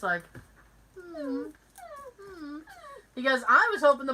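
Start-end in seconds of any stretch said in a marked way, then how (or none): 1.0-2.6 small, soft sad-face whimper; pouting little murmurs, put on; mock sad. Shown as a demonstration. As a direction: hum pitifully with a pout. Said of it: "I'm doing my sad face".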